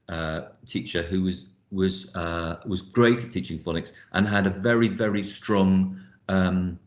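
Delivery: usual speaking voice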